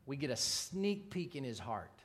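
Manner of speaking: ordinary delivery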